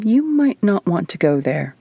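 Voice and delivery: usual speaking voice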